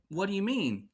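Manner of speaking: a hint of a puzzled little girl; little daughter. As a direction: daughter questioning her dad, lightly voiced child. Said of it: "She said".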